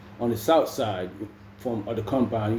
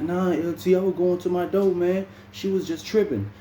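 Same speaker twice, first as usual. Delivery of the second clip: higher, whiny excuse to the lieutenant; pleading excuse; 7 semitones higher than usual. Retold or imitated. Inmate pleads with the lieutenant, higher and whiny. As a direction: talk your way out of it, pitched up.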